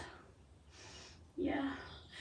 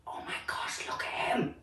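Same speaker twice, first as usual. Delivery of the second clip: amazed animals whispering; hushed, astonished gossip; awed whisper; 16 dB quieter than usual. Acted. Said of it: "They're like".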